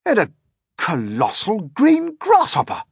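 high, loud, stammering disbelief; captain can't believe his eyes; astonished captain. A narrator's reading. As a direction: stumble over what the telescope shows. Captain keeps reporting creatures.

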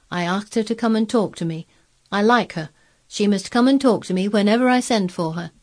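put-on imperious boy Colin. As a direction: declare it like a little rajah.

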